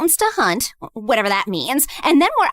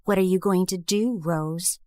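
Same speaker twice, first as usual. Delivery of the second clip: low, much softer, cold taunt; cold dare; 10 semitones lower, 7 dB quieter than usual. Acted. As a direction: challenge her quietly from low down. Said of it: "like she was daring me".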